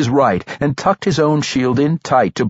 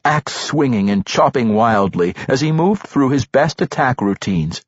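ordinary delivery, nothing marked

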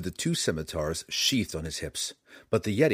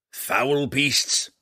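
grumbling dwarf, higher and louder; gruff gravelly dwarf voice; 5 semitones higher, 5 dB louder than usual. A narrator's reading. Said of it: "he grumbled".

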